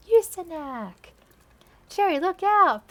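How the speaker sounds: slow loud dramatic cry; shouted warning. Acted. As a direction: call out the warning slowly.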